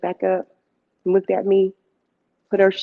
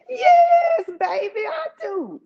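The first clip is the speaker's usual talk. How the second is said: flirty aunt; 12 semitones higher, much slower than usual. Retold or imitated.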